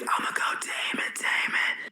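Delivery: harsh forced whisper, like a hushed scream; whisper yelling. Shown as a demonstration. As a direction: scream it, but only in a whisper. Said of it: "I would just, like, whisper scream".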